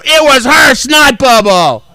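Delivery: high, loud, very slow drawn-out answer; mock sing-song answer. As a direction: stretch it out like a smug kid. His deadpan answer.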